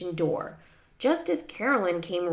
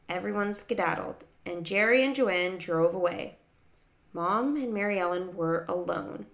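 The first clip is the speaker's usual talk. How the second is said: ordinary delivery, nothing marked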